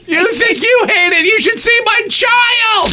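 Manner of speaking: very high, stretched-out holler; mock yelling. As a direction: shout it across to another car. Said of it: "I yell all my compliments".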